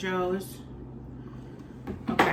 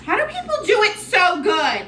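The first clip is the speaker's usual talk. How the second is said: exasperated; 10 semitones higher, 6 dB louder than usual. Real, not acted. Frustrated with whipped cream.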